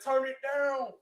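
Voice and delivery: high, loud command; sharp order. Acted. Sister ordering the girl to stop, loud.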